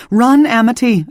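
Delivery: high, loud command to flee; urgent shout. A narrator's reading. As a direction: yell at her to run. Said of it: "I shouted".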